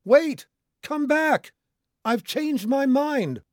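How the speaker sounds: high, loud, desperate yell with drawn-out words; hysterical screaming. A narrator's reading. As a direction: scream after someone who is leaving. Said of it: "screaming hysterically".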